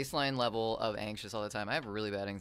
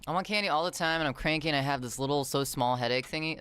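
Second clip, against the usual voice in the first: whiny complaint; 4 semitones higher, 7 dB louder than usual. Real, not acted.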